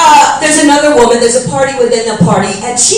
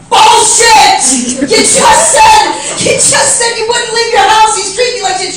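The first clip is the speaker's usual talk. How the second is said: angry retort; 8 semitones higher than usual. Retold or imitated. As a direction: snap back at her, pitched up. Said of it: "I'm like".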